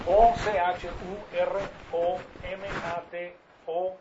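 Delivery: loud, slow letter-by-letter spelling; each letter loud and drawn out. Shown as a demonstration. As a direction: spell it out slowly and loudly for the class.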